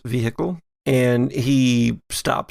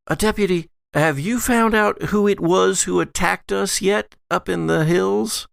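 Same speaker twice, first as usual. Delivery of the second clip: lady character voice; 7 semitones higher than usual. Acted. GM voices Allison questioning deputy.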